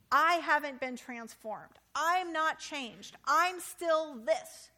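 drawn-out, deflated complaint; slow, sulky Moses. Acted. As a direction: Moses dragging out his protest slowly.

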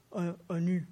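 deep Esau voice. Pretend. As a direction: Jacob fakes his brother's voice with a hesitant "Uh, Ani.". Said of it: "in the deep Esau voice".

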